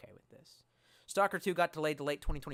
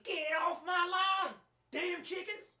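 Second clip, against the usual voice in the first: high, put-on cranky yokel; grumpy old farmer; slower than usual. Streamer acting as an old farmer, cranky yell. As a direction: play a cranky old man chasing chickens.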